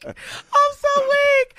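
high, slow, laughed-out words; barely getting it out through real laughter; weak with laughter. Real, not acted. Co-host cracking up over a listener's joke, breathless laughter. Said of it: "I'm weak".

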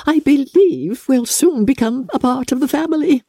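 eager to impress the grand visitor; fluttering mother. A narrator's reading. Proud mother boasts to the noble guest, gushing.

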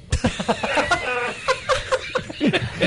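high burst of laughter; real laughter. Real, not acted. Guest laughing at own bad children joke, high laughter.

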